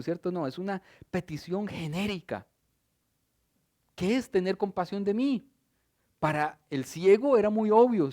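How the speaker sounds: usual speaking voice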